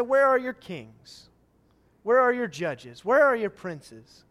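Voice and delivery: slow, loud, booming questions; loud taunt. Acted. God taunting his people, slow and booming. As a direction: call them out, demanding.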